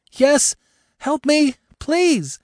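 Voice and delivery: high, loud, desperate pleading; panicked cry for help. Acted. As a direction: scream for help from inside the car. Trapped woman calling for help.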